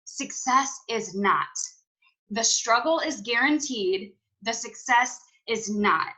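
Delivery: slow, deliberate, each word weighted; slow motivational emphasis; slow emphatic repeat. Shown as a demonstration. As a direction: spell it out slowly so it sinks in. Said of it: "I'm gonna repeat everything twice".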